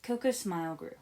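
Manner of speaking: ordinary delivery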